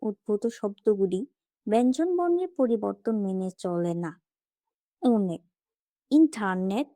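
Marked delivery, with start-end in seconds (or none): none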